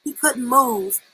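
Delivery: plain reading voice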